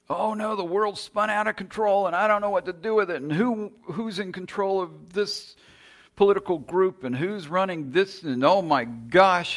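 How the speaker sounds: high, loud frantic worrying; hammed-up panicky believer; mock panic. Panicked believer voiced in sermon.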